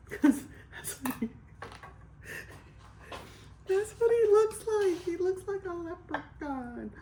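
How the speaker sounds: high-pitched, cracking up while talking; laughing. Real, not acted. Mocking someone's looks to viewers, laughing too hard to talk. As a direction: squeal it out through laughter, high. Cracking up at her own joke.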